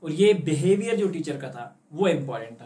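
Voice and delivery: ordinary delivery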